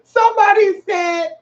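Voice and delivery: high, slow crying shriek. Real, not acted. Sudden loud outburst.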